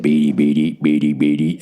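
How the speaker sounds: low, droning robot chirp; robot beeping. Retold or imitated. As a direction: do the little robot's beeping before it speaks. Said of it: "What if the robot said".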